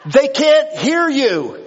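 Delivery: high, loud, slow exasperated outburst; exasperated shout. Real, not acted. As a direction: throw up your hands at the pointless honking. Preacher mocking honking drivers.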